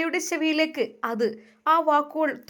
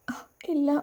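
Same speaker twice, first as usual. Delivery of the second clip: timid whisper; 4 dB quieter than usual. A narrator's reading. Frightened bride on wedding night.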